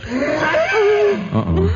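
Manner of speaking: alarmed uh-oh. Acted. Sudden alarm near the cages.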